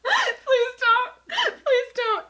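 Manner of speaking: high, loud pleading through laughter; laughing plea. Real, not acted. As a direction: beg him to stop, laughing. Begging partner to stop moaning.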